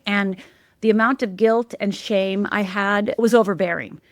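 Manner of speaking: ordinary talk, nothing marked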